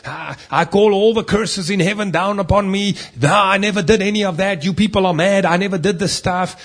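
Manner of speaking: loud, high, desperate denial shouting; frantic denial. Retold or imitated. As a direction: deny everything in a panic, loudly. Peter's frantic denial, cursing.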